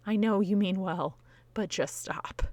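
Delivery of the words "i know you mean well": ordinary talk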